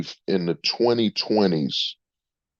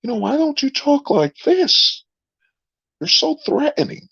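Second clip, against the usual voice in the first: much higher, fast, prissy voice; mocking shocked bar patron. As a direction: squeal it like a scandalized bargoer. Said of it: "you'll see that".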